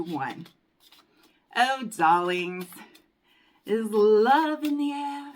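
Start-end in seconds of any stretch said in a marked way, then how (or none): 1.6-5.3 slow, much louder and higher sing-song; theatrical love is in the air; dramatic. Acted. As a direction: announce it like a grand romantic reveal. Dramatic reveal of love card.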